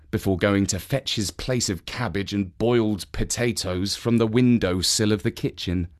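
ordinary delivery, nothing marked

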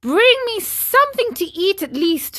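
high-pitched urgent plea; hungry marionette pleading. Acted. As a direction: beg for food as a puppet. Trapped marionette begs for food.

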